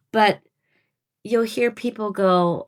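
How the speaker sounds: ordinary talk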